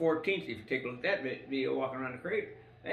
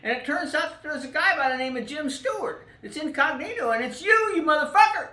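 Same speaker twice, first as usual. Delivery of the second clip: much higher and louder, building to a yell; a triumphant gotcha, shouted out; excited reveal; 11 semitones higher, 9 dB louder than usual. Retold or imitated. Retelling the friend exposing him as incognito, rising to a mock shout.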